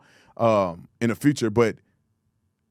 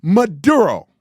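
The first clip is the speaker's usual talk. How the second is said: Maduro said with a Spanish accent, louder; mock Spanish accent; 4 semitones higher, 6 dB louder, slower than usual. Shown as a demonstration. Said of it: "It's not Maduro".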